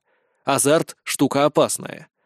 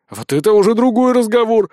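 loud, higher delighted voice; 6 semitones higher, 6 dB louder than usual. A narrator's reading. Fat soldier delighted at the bigger stake. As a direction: gloat with joy. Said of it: "обрадовался форточник".

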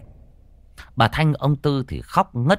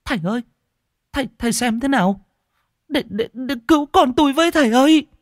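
much higher, fast, louder sobbing plea; tearful begging; 5 dB louder, faster than usual. A narrator's reading. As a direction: beg the monk through tears. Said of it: "chạy lại túm tay gã mếu máo". (giọng mếu máo van nài thầy)

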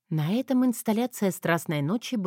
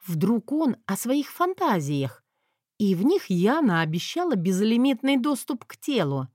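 ordinary delivery

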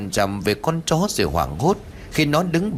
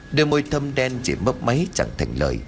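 ordinary delivery

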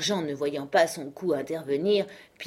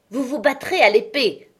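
louder, higher, beside himself; acted angry exclamation; enraged outcry; 6 semitones higher, 6 dB louder than usual. A narrator's reading. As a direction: cry out the demand in fury. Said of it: "s'écria monsieur de Pontcassé, hors de lui".

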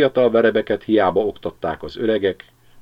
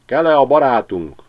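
csiripelő veréb; 7 semitones higher, 7 dB louder than usual. A narrator's reading.

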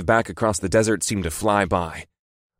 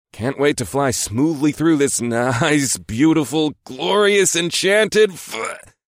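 shaky voice; 7 semitones higher, much slower than usual. Real, not acted. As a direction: voice shaking, rising higher, slowing. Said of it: "My voice started to shake".

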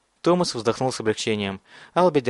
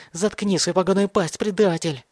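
high, enraged accusation; frantic yelling; 7 semitones higher than usual. A narrator's reading. Mad boy calls Thomas a traitor, high enraged yell. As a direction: hurl the insult in a raised pitch. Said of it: "завопил Бен".

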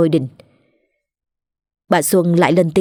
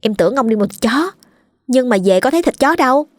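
put-on higher, gossiping girl's voice; gossipy servant girl; 4 semitones higher than usual. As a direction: lean in and spill the gossip in a girl's voice.

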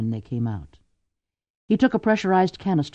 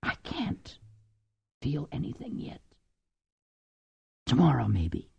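numb whisper; 8 dB quieter than usual. A narrator's reading. Numb shocked mother.

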